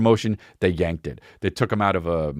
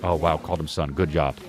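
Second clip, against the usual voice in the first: normal talking voice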